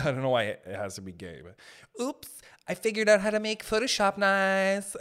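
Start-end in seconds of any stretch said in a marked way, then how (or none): 2.0-5.0 higher, put-on airy voice; mock a gushing tutorial maker; mock camp voice. Mocking a YouTuber who stumbled on a trick, camp voice.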